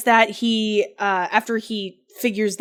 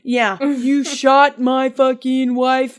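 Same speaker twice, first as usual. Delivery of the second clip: raised, slow, emphatic outrage; angry accusation; 4 semitones higher, 6 dB louder, slower than usual. Acted. Ethan confronting his old friend Chris, angry accusation. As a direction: accuse him loudly, stretching each word.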